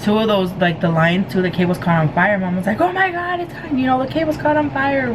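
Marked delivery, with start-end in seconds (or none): 2.8-5.1 high, panicked mom voice. Retold or imitated. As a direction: shriek it out like a frightened mother. Mom sees cables catch fire.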